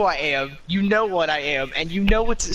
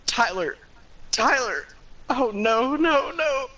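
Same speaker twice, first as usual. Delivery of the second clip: overacted cry, rising higher; hammy despair; 8 semitones higher, slower than usual. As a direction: wail after him like a soap star. Calling after him, crying out.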